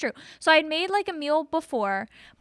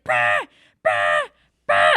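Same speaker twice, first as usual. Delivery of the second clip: much lower, blaring beeps; imitating fire alarm; 6 dB louder than usual. Telling friend how the apartment alarms blared, in a low buzzing imitation. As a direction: buzz out the alarm like a machine. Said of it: "That sounds just like them".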